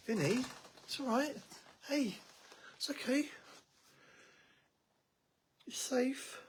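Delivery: high, gentle coaxing to a scared pet; soothing pet talk. Real, not acted.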